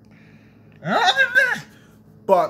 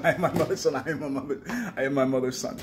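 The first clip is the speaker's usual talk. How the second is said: ordinary delivery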